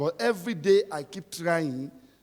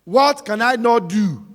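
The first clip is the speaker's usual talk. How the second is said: shouting preacher; 7 semitones higher, 9 dB louder than usual. Acted.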